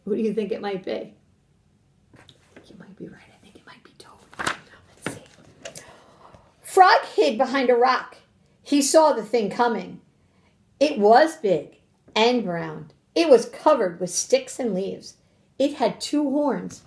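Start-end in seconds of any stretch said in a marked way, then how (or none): 2.7-5.8 hushed, conspiratorial whisper; very soft suspenseful aside. Pretend. 6.6-16.8 put-on emphatic storybook narration to children; deliberate read-aloud with punched words; suspenseful story reading.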